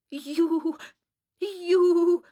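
high, loud outraged gasping of the word; shocked stammer. A narrator's reading. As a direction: stammer as if too appalled to go on. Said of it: "she stammered".

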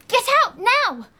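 indignant shout. Acted. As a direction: shout the intruder out of your room. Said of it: "You don't shout".

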